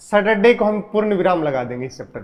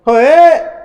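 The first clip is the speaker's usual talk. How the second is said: ball sound effect; 7 semitones higher, 8 dB louder, much slower than usual. Retold or imitated. Teacher imitates the ball flying.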